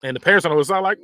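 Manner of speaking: usual speaking voice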